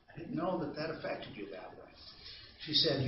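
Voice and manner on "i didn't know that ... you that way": quiet, gentle apology; a hint of a soft, sincere husband. Husband apologizing to his angry wife, softly sincere. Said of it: "politely and sincerely apologized".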